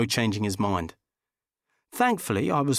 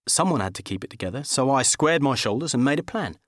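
ordinary delivery

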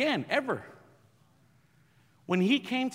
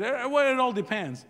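higher, louder, stammering evasive reply; hesitant dodge; 8 semitones higher, 7 dB louder than usual. Retold or imitated. Pastor nervously answering the armed officer, hesitant and high. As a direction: stall nervously with eh. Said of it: "I just said".

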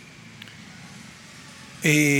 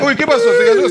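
high, louder startled cry; surprised; 9 semitones higher, 5 dB louder than usual. Real, not acted. Guest reacting to something falling at the party, surprised. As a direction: react to a crash.

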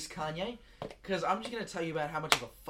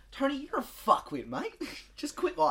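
high, louder telling-off with a laugh breaking in; blunt Australian address; 10 semitones higher, 5 dB louder than usual. Pretend. Australian to Australian message to Tony, high mock scolding. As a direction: tell him off as a mock public message. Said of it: "Australian to Australian".

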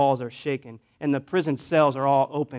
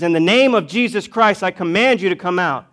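loud, raised retold command; annoyed command; 4 semitones higher, 7 dB louder than usual. Paul turns on the demon, imitated loud command. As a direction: order it out forcefully, as a preacher acting Paul. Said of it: "Paul gets annoyed with this woman".

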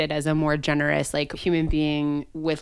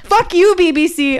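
much higher, loud indignant yell; mock furious; 11 semitones higher, 6 dB louder than usual. Real, not acted. Cursing the BBC over the show, loud and high. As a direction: yell it like a fed-up fan.

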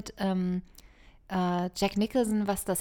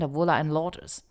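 normal talking voice

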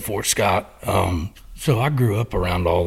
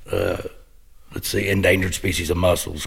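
normal talking voice

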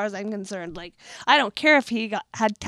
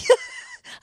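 high squealing laugh at herself; high burst of laughter. Real, not acted. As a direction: crack up at the failed accent. Laughs at own failed accent.

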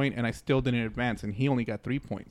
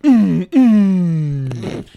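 two loud, abrupt closed-mouth hums; imitated tic grunts; 4 semitones higher, 14 dB louder than usual. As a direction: punch out a double tic hum. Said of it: "I think that was, like, his tick".

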